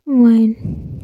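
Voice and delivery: usual speaking voice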